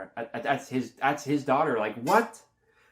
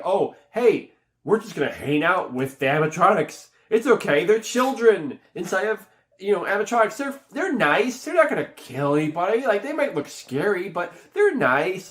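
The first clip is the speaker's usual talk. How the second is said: high, louder mock-innocent gushing; lightly mock someone insisting it's all harmless; singsong naive cheer; naive reassurance; 7 semitones higher, 5 dB louder than usual. Mimics film's naive trust in robots.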